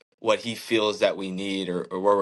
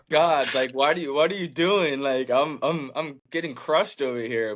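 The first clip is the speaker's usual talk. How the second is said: exasperated plea to God; 5 semitones higher than usual. Retold or imitated. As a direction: throw it up to God with a laugh, pleading.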